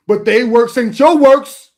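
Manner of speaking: laughing emphasis. Real, not acted. Laughing punchline, repeated.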